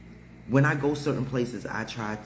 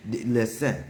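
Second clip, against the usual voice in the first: usual speaking voice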